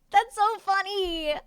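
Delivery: slow, high laugh-through; amused laughing line. Real, not acted. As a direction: giggle the words out. Laughing at a comment.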